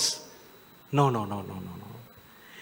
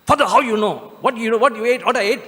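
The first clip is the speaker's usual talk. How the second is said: put-on puzzled young man; 6 semitones higher, 4 dB louder, faster than usual.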